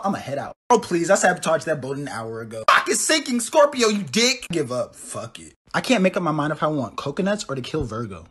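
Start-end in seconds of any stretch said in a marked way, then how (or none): none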